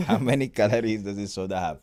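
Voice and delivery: bad Indian accent, laughing; a deliberately bad Indian accent. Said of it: "bad Eng- Indian accent".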